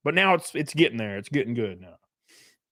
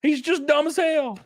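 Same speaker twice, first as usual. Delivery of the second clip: usual speaking voice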